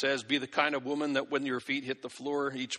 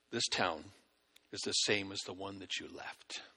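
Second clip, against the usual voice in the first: put-on grave wise old man; 6 semitones lower, 7 dB quieter than usual. Wise man's verdict again.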